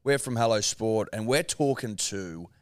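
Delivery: measured presenter read, starting high and loud; radio host ident. Real, not acted. Host reintroducing the show and guest on air, presenter style. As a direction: announce the show and guest to listeners.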